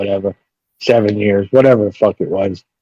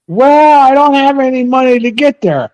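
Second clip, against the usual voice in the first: higher mock voice of a sister asking for money; mimicking sister; 13 semitones higher, 5 dB louder than usual. Teller mimics half sister begging for cash, higher mock voice. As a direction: ham up the sister's sweet ask.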